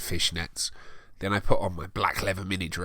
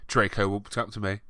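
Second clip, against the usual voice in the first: ordinary talk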